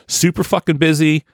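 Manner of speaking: normal talking voice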